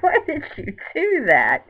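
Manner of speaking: half-laughing indignant protest; upset why. Retold or imitated. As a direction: cry out at her like a betrayed fan. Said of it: "but I was so pissed".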